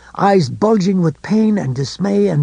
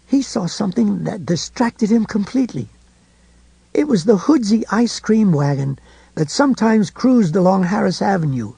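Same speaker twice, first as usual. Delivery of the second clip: plain reading voice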